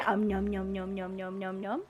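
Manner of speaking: soft, low nom-nom noises; munch quietly and playfully on pretend food. Mimes munching the s'mores, very soft.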